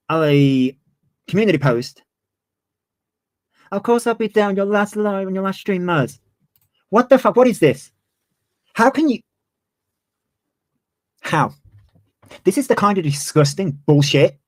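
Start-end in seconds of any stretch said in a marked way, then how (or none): 3.7-6.2 higher, sing-song mock of a fake friend; mocking quote. Mocking Muzz's supporters to her stream, higher sing-song. 6.9-11.5 outraged disbelief. Real, not acted. Calling out Muzz's friends on stream, outraged.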